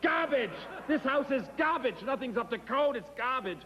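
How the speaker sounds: high ranting yell of garbage; ranting plumber. Retold or imitated. Plumber yelling about the old house, high rant. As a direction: shout it like a fed-up plumber. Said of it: "he's running around going".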